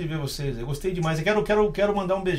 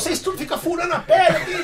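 lady pastor taking the microphone, high mock voice; imitating old lady pastor; 11 semitones higher than usual. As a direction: ham up a stern church lady. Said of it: "Mas é uma senhora, né, quadrangular".

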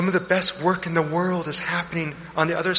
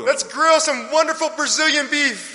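excited joyful father; 7 semitones higher than usual. Acted. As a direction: throw a party for the lost son. Father celebrates son's return.

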